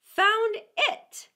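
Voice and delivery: loud, high, irritated emphasis; rather cross. A narrator's reading. Mouse correcting the interrupting duck, rather cross. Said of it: "the Mouse replied rather crossly".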